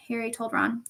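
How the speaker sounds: ordinary delivery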